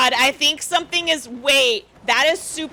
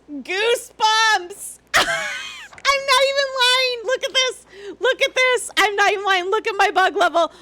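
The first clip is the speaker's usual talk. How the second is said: overjoyed and shaken; 7 semitones higher than usual. Real, not acted. Overwhelmed excitement, high.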